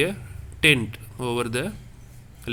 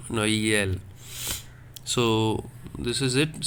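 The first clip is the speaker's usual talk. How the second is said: usual speaking voice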